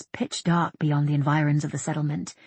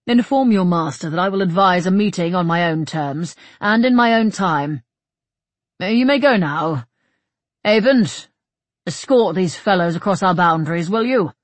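loud, measured lordly orders; raised, imperious character voice; commanding leader; 3 semitones higher, 8 dB louder than usual. Acted. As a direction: dismiss them with ringing authority.

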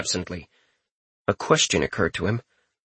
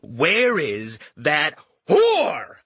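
cold menace; 4 semitones higher, much slower than usual. Acted. Richter furious at captor.

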